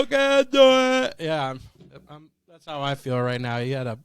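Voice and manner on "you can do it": high, loud, slow drawn-out cheer; hype cheer. Pretend. Cohost cheering the show on, high and loud. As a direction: cheer it out like a coach.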